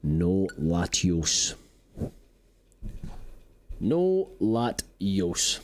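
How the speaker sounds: slow drawn-out no. Acted. Drawn-out mock refusal.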